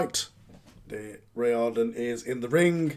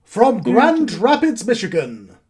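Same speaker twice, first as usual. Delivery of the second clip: ring announcer; 5 semitones higher, 9 dB louder, much slower than usual. Acted. Announcer introducing The Freak, theatrical ring announcement. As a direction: build up to a big name reveal.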